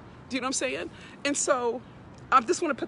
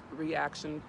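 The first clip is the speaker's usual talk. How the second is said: normal talking voice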